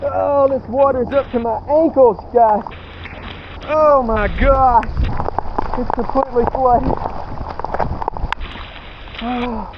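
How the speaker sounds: high, breathless and frantic; flustered in the rain, voice up high. Real, not acted. Drenched in storm, night camp.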